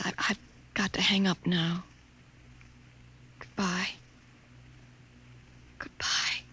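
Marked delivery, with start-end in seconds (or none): 3.4-6.4 breathy whispered farewell, barely voiced; a whispered goodbye, holding back tears. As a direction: let the goodbye fall to a whisper. Tearful forced farewell on phone.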